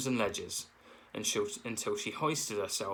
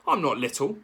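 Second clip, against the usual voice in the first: snapped; 5 semitones higher, 6 dB louder than usual. A narrator's reading. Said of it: "snapped Thorn under his breath".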